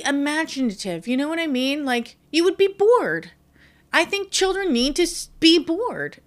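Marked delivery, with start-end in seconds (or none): 1.9-6.2 emphatic. Real, not acted. Passionate rant on boredom.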